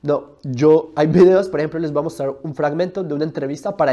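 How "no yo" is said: ordinary talk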